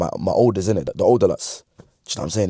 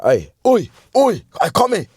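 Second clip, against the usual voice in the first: calling out; 10 semitones higher, 4 dB louder than usual. Retold or imitated. Guy calling him over.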